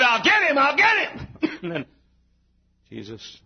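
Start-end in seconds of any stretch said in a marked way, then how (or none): 0.0-1.3 high, rising preacher's shout; preacher's devil cry. Acted. Preacher voicing the devil after Jesus, high preaching cry. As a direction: crow it as the devil, climbing higher.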